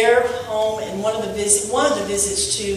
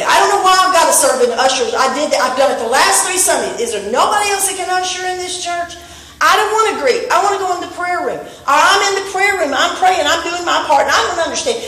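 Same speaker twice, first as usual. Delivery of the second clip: whiny church member; 6 semitones higher than usual. Acted. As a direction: whine like an overworked usher. Acting out a complaining church member.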